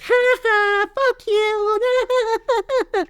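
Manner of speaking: very high mocking sing-song; shrieked mock laugh and insult; taunting jeer. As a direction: rub it in like a bully. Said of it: "be like".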